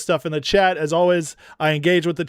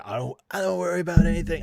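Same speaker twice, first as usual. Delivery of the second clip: bad Trump impression; 3 dB quieter, faster than usual. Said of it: "I can't do Trump".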